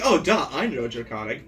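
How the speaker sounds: ordinary talk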